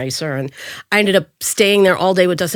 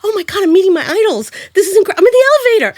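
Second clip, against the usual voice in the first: high, fast, gushing with a cry and laugh; overwhelmed fan meeting her idols, tearful laughing squeal; starstruck squeal; 12 semitones higher, 5 dB louder, faster than usual. Retold or imitated. Meeting Spielberg in the elevator, starstruck squealing. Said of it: "I'm like".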